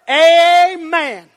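loud, long-held "Amen"; exultant preacher's amen. Real, not acted. Preacher crowning the verse for the church, exultant amen. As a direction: stretch out "Amen" as a sermon climax. Climax of preached scripture quote.

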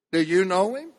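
ordinary talk, nothing marked

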